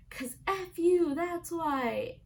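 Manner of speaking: put-on high, slow taunting kid voice; bratty little boy. Little boy from a Vine mouthing off to his sister, high and slow. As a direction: sass back like a cheeky little boy. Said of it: "And he's like".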